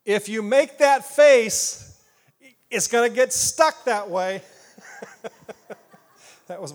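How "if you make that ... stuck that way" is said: slow, loud warning like a mom. Retold or imitated. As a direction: scold like a mother at a pulled face. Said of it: "my mom's favorite way of telling me".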